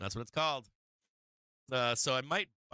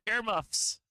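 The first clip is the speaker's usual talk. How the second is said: higher, louder mock warning to the kids; playful earmuffs warning; 10 semitones higher, 3 dB louder than usual. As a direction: call it out to the kids with a grin. Telling kids to cover ears.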